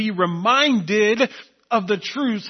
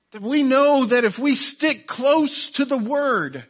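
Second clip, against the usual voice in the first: raised, emphatic pulpit delivery; impassioned preaching; 3 semitones higher, 3 dB louder than usual. Real, not acted. Preacher urging the church to stick close to the word, impassioned. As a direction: drive each phrase home, leaning hard on key words. Preaches at full force with Bible verses.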